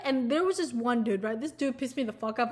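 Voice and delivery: ordinary talk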